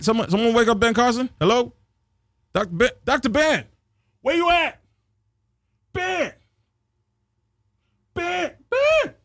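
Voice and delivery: calling out high and rising to wake someone; mock yelling for a sleeping man.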